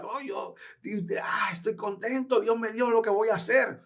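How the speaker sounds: high, bubbling eager protest; eager teen. Retold or imitated. Young convert telling his pastor he's happy, high and eager. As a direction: insist you're thrilled, pitched high and eager.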